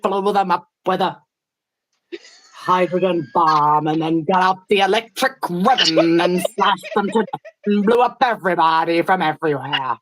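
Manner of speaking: imitating a speaker. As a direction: draw the words out as an impression of the storyteller. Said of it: "I'm getting closer".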